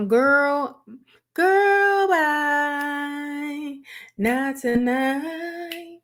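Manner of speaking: put-on high, drawn-out sassy girl bye; sassy brush-off. Brushing off Sierra in a high teasing singsong. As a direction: brush her off in a sing-song, slow not tonight.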